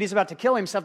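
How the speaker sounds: usual speaking voice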